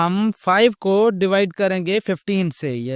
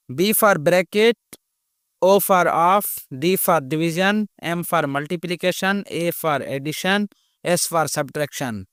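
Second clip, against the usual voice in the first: slow dictation; much slower than usual. Shown as a demonstration. Teacher spells out BODMAS letters.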